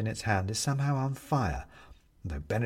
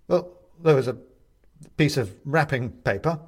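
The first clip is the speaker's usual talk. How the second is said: nervous; 4 semitones higher, 5 dB louder than usual. A narrator's reading. Benedict nervous answer.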